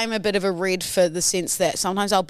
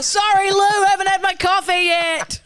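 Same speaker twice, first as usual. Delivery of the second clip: mock CEO apology; 10 semitones higher, 3 dB louder than usual. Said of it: "The C E O just runs you over".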